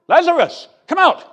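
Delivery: commanding call. Retold or imitated. Jesus at the tomb, calling Lazarus out loudly. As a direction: call him out with full authority.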